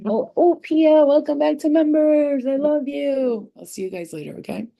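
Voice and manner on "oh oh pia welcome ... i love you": higher, louder, slow gushing welcome; excited greeting. Real, not acted. Welcoming Pia back to the members, higher gushing delight. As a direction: gush over her coming back.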